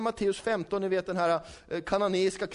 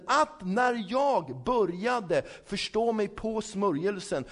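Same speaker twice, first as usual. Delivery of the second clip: raised, higher preaching voice; fervent preaching; 5 semitones higher, 4 dB louder than usual. Acted. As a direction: preach it out to the congregation. Preacher driving home point.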